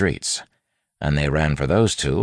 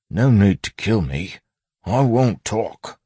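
higher, louder bandit plea; urgent pleading; 8 semitones higher, 5 dB louder, slower than usual. A narrator's reading. Bandit begging his supposed rescuer not to kill, urgent pleading. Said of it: "the man said urgently".

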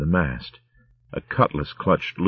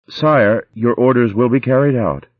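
higher, louder dutiful reply; obedient servant; 6 semitones higher, 4 dB louder than usual. Acted. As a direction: answer your master dutifully. Sagitar answers her lord.

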